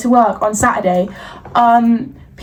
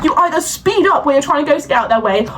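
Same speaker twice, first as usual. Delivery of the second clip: usual speaking voice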